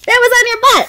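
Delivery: high, amused and emphatic; playful excited. Real, not acted. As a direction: pitch it up playfully. Mom repeats the line, second higher.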